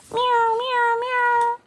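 very high plaintive meowing; high kitten cries, sad. Acted. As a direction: mew like a lost kitten stuck up a tree. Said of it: "came a sad cry from above".

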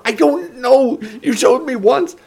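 high, desperate protest; desperate yell. A narrator's reading. Taryn protests to the screaming woman, yelling desperately.

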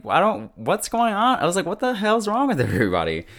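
retold disbelief in a high, exasperated voice; baffled outburst, acted out for the story; bewildered reaction. Retelling own bewildered reaction.

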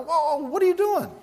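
high-pitched, startled retelling; startled alarm. Retold or imitated. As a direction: act out being taken aback mid-prayer. Acts out reaction to her screaming.

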